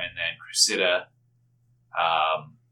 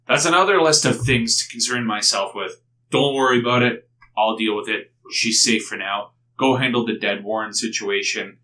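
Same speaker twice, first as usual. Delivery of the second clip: firm loud reassurance; 3 semitones higher, 7 dB louder than usual. Acted. NPC briskly dismisses party's concerns.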